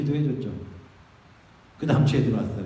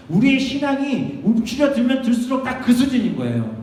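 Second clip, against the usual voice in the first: loud, high-pitched sermon exhortation; impassioned preacher urging boldness; rousing preaching; 8 semitones higher, 6 dB louder than usual. Real, not acted. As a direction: drive it home to the congregation with force. Preaches at full force.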